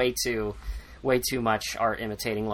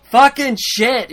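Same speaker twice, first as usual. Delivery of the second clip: slow, loud, high curse; pissed-off outburst; 11 semitones higher, 10 dB louder, slower than usual. Retold or imitated. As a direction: spit the curse out slowly, furious. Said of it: "really pissed".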